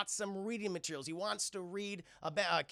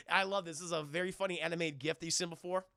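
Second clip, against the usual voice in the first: usual speaking voice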